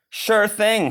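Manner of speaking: a hint of cheery Papa; lightly voiced Papa Bear.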